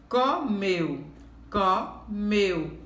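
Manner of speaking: "Comeu" split as co... MEU, both times; syllable stress. Shown as a demonstration. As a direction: pause after "co", then lean on "meu". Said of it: "meu é o pedacinho mais forte".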